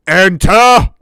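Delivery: huge booming shout; dopey cartoon bellow. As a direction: boom it like a giant. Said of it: "you hear a booming voice".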